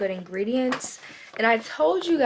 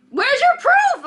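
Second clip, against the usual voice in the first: high, loud, indignant demand; indignant shout; 13 dB louder, slower than usual. Retold or imitated. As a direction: fire it back at her, outraged. Retold argument with receptionist.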